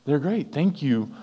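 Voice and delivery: a pleasantly surprised friend; surprised delight. Retold or imitated. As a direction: caught off guard and touched. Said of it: "take 'em by surprise".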